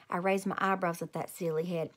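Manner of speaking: ordinary delivery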